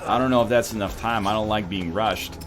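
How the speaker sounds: ordinary talk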